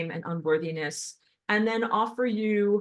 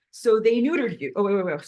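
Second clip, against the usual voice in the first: agitated; 6 dB louder than usual. Real, not acted. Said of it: "I'm getting so agitated here".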